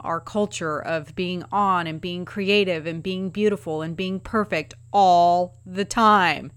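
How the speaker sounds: ordinary talk, nothing marked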